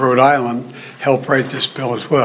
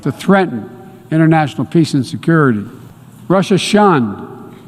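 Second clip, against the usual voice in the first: ordinary delivery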